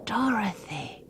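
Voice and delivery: high breathy delighted call; a soft excited gasp of her name; hushed delight; delighted exclamation. Acted. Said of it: "exclaimed a delighted voice".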